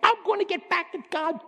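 high, strained voice of a sulking rebel; defiant complaint. Retold or imitated. A believer angry at God, high defiant whine. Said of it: "When you get angry at God and say".

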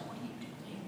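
very soft, low near-whisper; hushed question. Acted. God repeating the question to Elijah, hushed. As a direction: ask it under your breath.

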